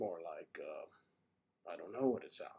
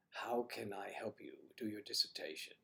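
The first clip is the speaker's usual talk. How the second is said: ordinary talk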